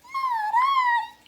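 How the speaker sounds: very high, loud squeak; squeaky mouse. A narrator's reading. Mouse refusing to help the hen, high squeaky voice. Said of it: "said the mouse".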